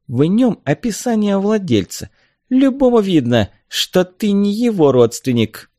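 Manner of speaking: high-pitched, disdainful explanation; scornful. A narrator's reading. Kangai woman belittling Bjork's claim, scornful. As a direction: point it out with contempt. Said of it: "презрительно отозвалась Кангайка".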